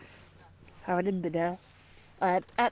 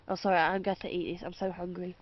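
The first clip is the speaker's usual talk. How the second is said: ordinary talk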